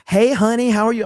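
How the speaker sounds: high, loud catcall from a showgirl; aggressive showgirl. Retold or imitated. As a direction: imitate a pushy showgirl calling out. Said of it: "being very aggressive".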